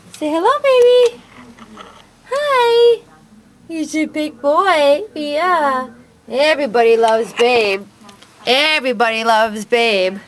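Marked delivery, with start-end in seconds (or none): 0.1-5.9 much higher and louder cooing. Real, not acted. Owner introducing her cat to viewers, high cooing. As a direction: call sweetly to the cat.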